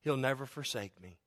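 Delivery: ordinary delivery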